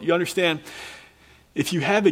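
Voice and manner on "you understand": normal talking voice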